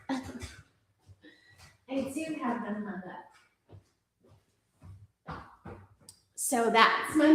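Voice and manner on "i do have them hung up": the words do have them hung up repeated much softer, almost under the breath; near whisper. Shown as a demonstration. Repeating her own line to viewers, soft and amused.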